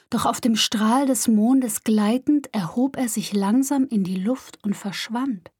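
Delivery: ordinary delivery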